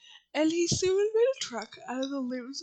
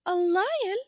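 loud, high, shocked little queen; alarmed cry; 8 semitones higher, 9 dB louder than usual. A narrator's reading. Queen of Mice hears of a lion, loud alarmed cry. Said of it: "cried the little Queen".

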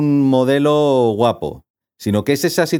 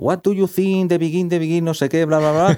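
put-on lightly raised, halting Spanish-accented English; a loose imitation of a reporter struggling in English; imitación ligera de periodista hablando en spanglish; mock Spanglish reporter; 5 semitones higher than usual. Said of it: "la periodista en Spanglish más o menos intenta preguntar".

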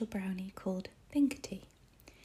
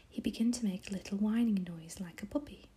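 ordinary delivery, nothing marked

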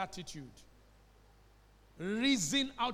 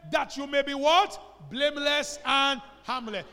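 loud reading; 5 semitones higher, 11 dB louder, slower than usual. Shown as a demonstration.

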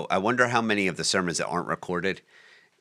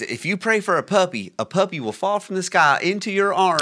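put-on Joel Osteen, thick Southern accent; 11 semitones higher, 4 dB louder than usual. Retelling a preacher's puppy promise from a clip, exaggerated Southern accent. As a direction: imitate a Southern televangelist, pitched way up. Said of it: "Very, very thick".

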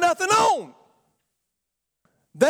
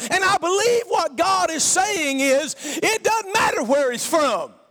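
preaching; 4 semitones higher than usual. Acted. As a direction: preach it with full force to the congregation. Preaching at full force.

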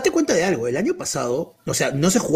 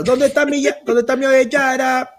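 high, slow hyped host call; club MC voice; 6 semitones higher than usual. Retold or imitated. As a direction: call out to the crowd like a DJ. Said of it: "la de animador de discoteca". (animador de discoteca)

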